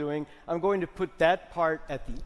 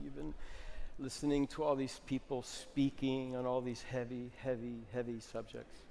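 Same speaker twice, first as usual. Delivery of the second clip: calm workshop leader easing into stillness; soft guided exercise voice; 2 semitones lower, 6 dB quieter than usual. Acted. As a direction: ease the room down gently. Leads a slumped, quiet body exercise.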